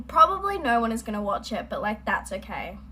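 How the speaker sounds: usual speaking voice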